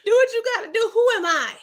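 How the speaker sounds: high-pitched laughing quote. Real, not acted. Recapping the show to chat, laughing high. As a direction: squeal it out through laughter. Laughing while quoting herself.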